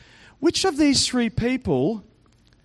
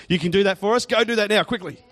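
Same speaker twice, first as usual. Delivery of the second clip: ordinary delivery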